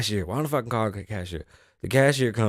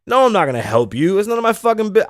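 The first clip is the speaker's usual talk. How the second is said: loud, climbing high in protest; indignant refusal; 11 semitones higher, 8 dB louder than usual. Retold or imitated. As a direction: refuse hotly, voice rising. Indignant answer to cashier.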